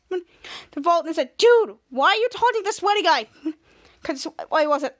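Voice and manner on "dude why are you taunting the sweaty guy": high, louder incredulous complaint; exasperated whine. Acted. Volt complains to A wol for taunting the villain, in a high exasperated whine. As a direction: whine it at the friend making things worse.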